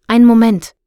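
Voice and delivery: loud call back. A narrator's reading. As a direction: shout back over the crowd. Calling back to her friend. (laut zurückrufend)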